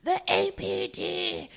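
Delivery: high, slow, drawn-out mock outrage; mock angry reviewers. Host mimicking Austin police reviewers, mock angry. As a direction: imitate furious complainers, stretching each letter. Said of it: "there were some really angry people".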